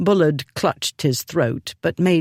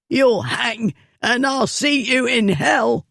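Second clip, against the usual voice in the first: higher, strangled curse; choked; 9 semitones higher than usual. Acted. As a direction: spit it through a tight throat. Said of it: "the fool choked out".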